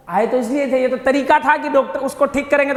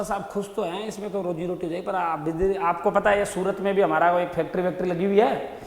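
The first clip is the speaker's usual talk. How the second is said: wheedling softly; 4 semitones lower, 8 dB quieter than usual. Retold or imitated. Merchant pressing for more softly.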